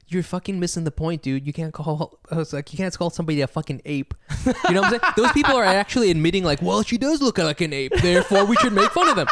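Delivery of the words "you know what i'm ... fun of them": laughing through the words, higher and louder; laughing disbelief. Real, not acted. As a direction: laugh at how absurd it is.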